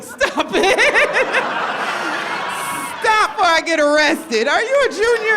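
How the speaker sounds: high, loud, squealing protest through laughs; laughing protest. Retold or imitated. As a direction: shriek it like a flustered teacher. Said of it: "I was like".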